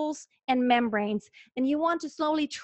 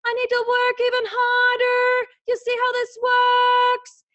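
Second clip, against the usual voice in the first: much higher and louder; more intensity; 11 semitones higher, 8 dB louder, slower than usual. Shown as a demonstration. Coach showing how intensity rises with pitch, much higher and louder. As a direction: talk with strong belting intensity. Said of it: "When I go even higher".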